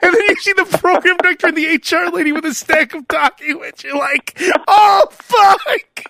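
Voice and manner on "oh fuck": loud high curse of shock. Retold or imitated. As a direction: shout it as the doom sinks in. Retold firing, shocked reaction.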